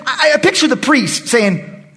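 ordinary talk

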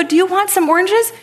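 high, loud eager question; flustered retold offer. Speaker offers neighbor oranges after hint, high mock eagerness. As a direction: play an over-eager, embarrassed offer.

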